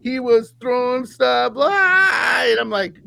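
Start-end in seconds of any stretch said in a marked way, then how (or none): 1.6-2.4 high, drawn-out imitated yell; mock yell. Host imitating quarterback yelling at the crowd, mock yell. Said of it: "he was yelling at the crowd".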